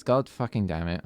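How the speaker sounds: ordinary delivery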